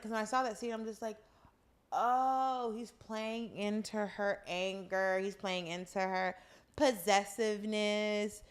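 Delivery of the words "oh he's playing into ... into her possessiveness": drawn-out, knowing delivery; slow realization. Retold or imitated. Viewer working out his jealousy plan, slow and knowing. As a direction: stretch each word as it dawns on you. Retells dawning realization watching scene.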